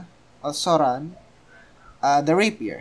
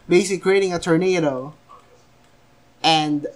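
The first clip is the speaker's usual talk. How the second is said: ordinary delivery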